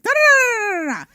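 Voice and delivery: high rapid da da da mimicking a rushed reader; imitating fast talk. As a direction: rattle off nonsense syllables like a rushed announcer. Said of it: "you're doing the whole".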